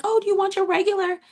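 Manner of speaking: very high, mock-eager offer; fawning server impression. Staff catering to the little prince, high fawning imitation.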